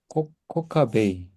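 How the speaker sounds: slow, syllable by syllable 코카베이; teacher sounding out 코카베이 slowly; slow model word. Shown as a demonstration. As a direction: draw out 코-카-베이 for learners. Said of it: "따라서 해보겠습니다".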